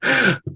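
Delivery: high laugh. Real, not acted. Laugh breaks the chant.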